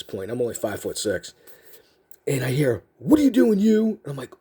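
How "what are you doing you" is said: higher-pitched imitation of a drill sergeant; drill sergeant catchphrase. Drill sergeant to the recruit who bumped him, high-pitched challenge. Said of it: "that's something he'd always say".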